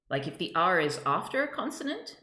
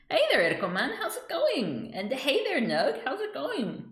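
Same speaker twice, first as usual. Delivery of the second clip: cheery greeting; 9 semitones higher than usual. Real, not acted. Greeting chat viewers.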